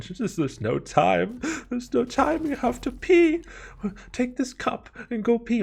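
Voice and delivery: very high, hammed-up frantic shriek; panicked mom. Mom urging son to hurry, hammed-up panicked squeal. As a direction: imitate a hysterical mother in squealing falsetto.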